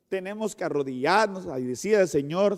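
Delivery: normal talking voice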